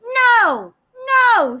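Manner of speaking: high, loud piglet refusal; little pig voice. Acted.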